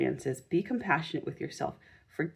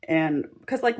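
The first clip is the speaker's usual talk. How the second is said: normal talking voice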